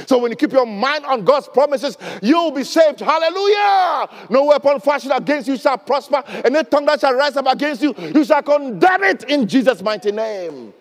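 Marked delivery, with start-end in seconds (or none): 3.0-10.7 loud, high hallelujah shout; preacher shouting praise to the church, loud and high. Real, not acted. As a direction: proclaim it with full force.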